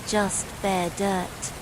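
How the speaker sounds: plain reading voice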